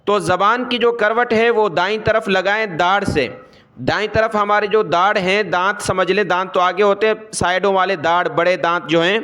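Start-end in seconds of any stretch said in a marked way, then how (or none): none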